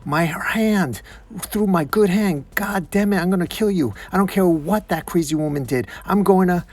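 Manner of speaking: high-pitched agonized ranting; furious, hurting outburst; pained angry yell. Acted. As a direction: yell in pain, then threaten revenge. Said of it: "As Deadshot yells".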